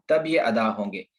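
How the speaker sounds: usual speaking voice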